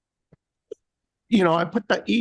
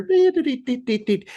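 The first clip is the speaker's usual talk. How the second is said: high mock beeping, fading; imitated alarm beeps; 7 semitones higher than usual. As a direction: beep like the stuck cart alarm.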